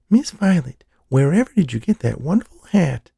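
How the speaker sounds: put-on little girl's high, sing-song Southern belle voice; a child playing a Southern lady with her dolls, high; southern accent. Little girl voicing her doll to another doll, in a Southern accent. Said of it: "in what sounded like a southern accent".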